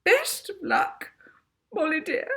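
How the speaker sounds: a teary sniff through the words; prim lady fighting back tears; sniffly. A narrator's reading. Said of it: "Miss Addiston sniffed".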